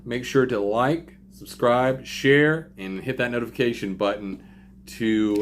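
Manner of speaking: loud, upbeat YouTuber sign-off; energetic outro. Real, not acted. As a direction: pump up the outro for viewers. Energetic vlog outro to viewers.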